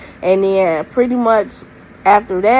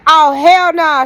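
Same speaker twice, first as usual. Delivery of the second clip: indignant; 7 semitones higher, 5 dB louder, slower than usual. Retold or imitated. Friend hears shocking news.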